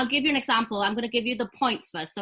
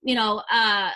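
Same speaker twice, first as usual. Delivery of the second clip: usual speaking voice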